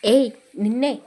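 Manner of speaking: loud menacing order for water; husband loudly threatening his child bride; threatening call. A narrator's reading. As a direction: bark at the frightened young wife. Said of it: "గట్టిగా సంతోషిని బెదిరిస్తూ అడిగాడు".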